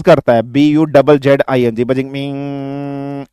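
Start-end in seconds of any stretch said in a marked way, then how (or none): 1.8-3.3 long, soft, drawn-out mosquito buzz; a quiet, slow whining buzz. Shown as a demonstration. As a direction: hum it thin and soft like a mosquito. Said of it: "ऐसे करता है".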